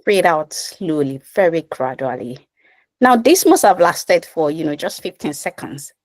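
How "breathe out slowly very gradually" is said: guided meditation. Acted. Guides slow exhale.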